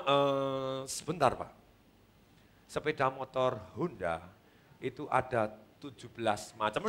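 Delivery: slow, low, soft salesman's reply; deliberately slow. Shown as a demonstration. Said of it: "Anda yang pelan coba". (ngomong pelan-pelan, rendah dan lambat)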